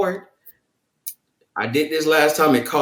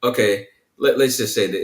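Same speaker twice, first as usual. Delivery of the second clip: dismissive tone; 5 semitones lower than usual. Shown as a demonstration. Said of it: "I don't think my tone was a playful tone".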